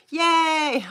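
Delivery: a high, loud little cheer; excited cheer. Real, not acted. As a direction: pop it out like a happy squeal. Cheering a viewer's comment.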